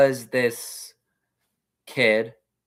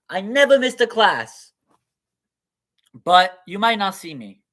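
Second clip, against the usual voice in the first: higher, louder pleading yeshiva student; student pleading with his rabbi, raised pitch; acted student; 6 semitones higher, 7 dB louder than usual. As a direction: beg the rabbi earnestly, voice up high. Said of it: "I like acting".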